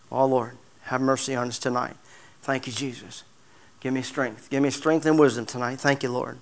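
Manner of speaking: soft, hushed plea to the Lord; murmured prayer. Real, not acted. Preacher pausing to pray to Jesus, soft hushed prayer. Quiet prayer mid-sermon.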